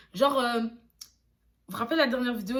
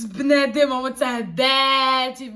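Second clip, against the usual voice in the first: loud emphatic example; 4 semitones higher, 11 dB louder, slower than usual. Shown as a demonstration. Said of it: "tout dépend de la façon dont tu l'emploies".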